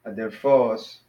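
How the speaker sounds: Adelphos with the accent on PHOS; stress on last syllable. Shown as a demonstration. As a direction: softly model Adelphos with final stress. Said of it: "put the accent at the end".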